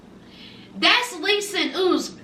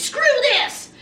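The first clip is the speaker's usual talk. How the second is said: mock outburst; 7 semitones higher than usual. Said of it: "She says".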